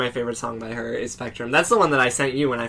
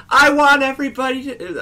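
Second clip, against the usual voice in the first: much higher and louder hippie plea; 12 semitones higher, 5 dB louder than usual. Retold or imitated. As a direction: overdo her dreamy call to the crowd. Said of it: "I'm being louder than she was".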